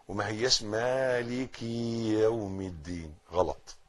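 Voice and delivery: usual speaking voice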